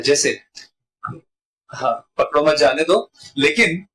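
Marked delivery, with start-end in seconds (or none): none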